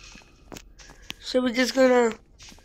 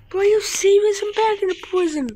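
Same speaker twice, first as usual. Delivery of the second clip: exasperated; 7 semitones higher, faster than usual. Real, not acted. Louder repeat.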